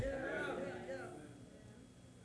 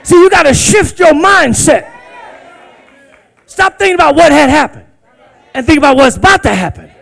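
loud high exhortation; fervent shouted preaching; 5 semitones higher, 11 dB louder than usual. Real, not acted. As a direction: urge them on at full voice. Preacher exhorting at full force.

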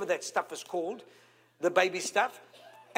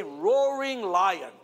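slow call-and-response; 3 semitones lower, much slower than usual. Shown as a demonstration. Preacher leading the congregation in a slow call. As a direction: stretch every syllable of roaring lion. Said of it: "Everyone say".

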